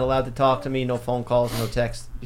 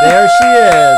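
much higher and louder excited greeting; excited shout; 14 dB louder, much slower than usual. Real, not acted. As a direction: greet Mom loudly on the screen. Mom appears on the FaceTime call.

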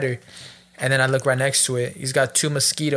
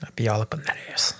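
whispered aside; 5 semitones lower, 7 dB quieter than usual. Real, not acted. Friend whispering a cheeky aside during the story. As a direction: whisper it under your breath.

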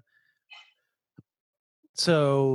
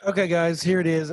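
normal talking voice

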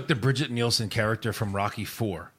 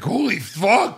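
higher-pitched imitated shock; mock shocked; 8 semitones higher, 4 dB louder, much slower than usual. Co-host mimics someone spotting the thing, mock shocked. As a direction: blurt it out as an astonished onlooker.

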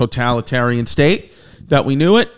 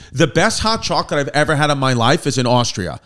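ordinary talk

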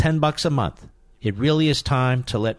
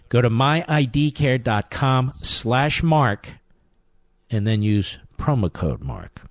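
slow read with each piece separated; slow, careful repeat; slower than usual. Shown as a demonstration. Host giving the web address one more time. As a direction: take it slow so they write it down. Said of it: "One more time".

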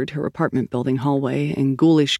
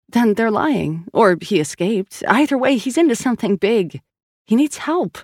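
higher, pressing insistence; worried sister pleading, pitch raised; urgent pleading; 6 semitones higher than usual. Acted. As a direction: argue urgently that he's in trouble. Sal urges concern for brother.

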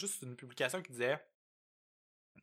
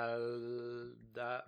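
slow spelled-out name; 6 dB quieter, much slower than usual. Shown as a demonstration. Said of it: "cherchez les bouteilles vertes".

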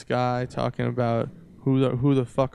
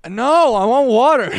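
mock yelled protest; 11 semitones higher, 9 dB louder than usual. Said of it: "And you're like".

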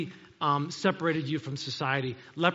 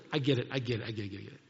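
sheepish concession; 7 semitones lower, 5 dB quieter, much faster than usual. Retold or imitated. Retells conceding to God's reminder.

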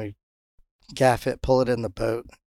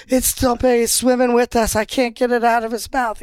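high, loud mock-frantic report; excited shouting; 11 semitones higher, 5 dB louder than usual. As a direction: imitate a mate hollering over a big fish. Acted mate fooled by yanked line.

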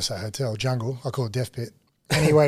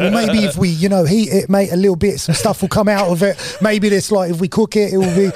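higher, louder, singsong musing about cooking the brick; mimicking Joey; 6 semitones higher, 5 dB louder than usual. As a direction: play the hopeful dopey mate. Voicing Joey figuring out brick.

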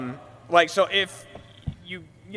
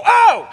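a sudden high loud shocked "Oh!"; British "Oh!"; 12 dB louder than usual. Shown as a demonstration. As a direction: blurt "Oh!" as if a murderer runs at you. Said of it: "and you go".

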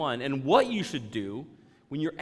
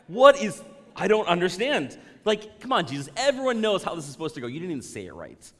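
louder and higher, mock-exasperated; mock exasperation; 3 semitones higher, 7 dB louder than usual. Churchgoer mock-protesting that Jesus got the prayer wrong. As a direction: protest like a fussy know-it-all.

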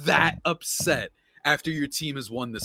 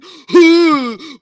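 mock gasp; 16 dB louder than usual. Mocking Boston fans' outrage.

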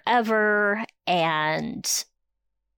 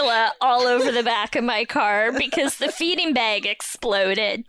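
mocking one-upmanship; 5 semitones higher, 4 dB louder than usual. Mocking other parents' complaints.

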